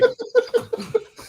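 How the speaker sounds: squealing laugh; real laugh; high burst of laughter. Real, not acted. Laughs at stress joke.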